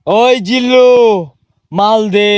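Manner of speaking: low, drawn-out command; film tough guy. Acted. Boss demands the stuff from Jillu, low tough-guy voice. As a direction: order the henchman in a deep hero voice. Plays menacing film hero.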